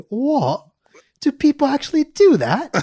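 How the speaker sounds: high, loud fake astonishment with a laugh; mock surprise. Accused of faking the accent, feigning shock. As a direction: play innocent, outraged and amused. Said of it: "And that's when I say".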